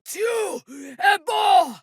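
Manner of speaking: slow, high, shouted war cry; battle cry. Acted. Warrior roars a slow, high battle cry in combat. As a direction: bellow it as you charge.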